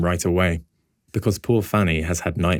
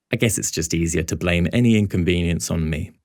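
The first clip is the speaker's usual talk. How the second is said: ordinary delivery, nothing marked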